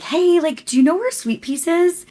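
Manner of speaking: high, loud, chirpy question to a stranger; sweet stranger voice. Retold or imitated. Asking a stranger for the bakery, high and chirpy. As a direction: put on a bright polite ask. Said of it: "I see this girl and I'm like".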